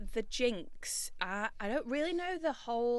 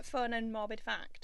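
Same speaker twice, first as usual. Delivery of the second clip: ordinary talk, nothing marked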